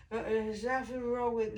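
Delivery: lightly imitating a drunk husband, blurry and halting; a mild take on a medicated man sounding drunk. Wife imitating her overmedicated husband insisting nothing's wrong, lightly drunk-sounding. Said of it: "he sounded like a drunk".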